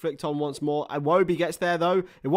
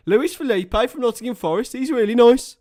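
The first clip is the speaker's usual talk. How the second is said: higher, louder Brummy accent; 5 semitones higher, 5 dB louder than usual. Shown as a demonstration. Streamer showing chat his Brummy accent, hammed up. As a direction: lean into the Birmingham accent. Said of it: "Is my Brummy accent good, lads?".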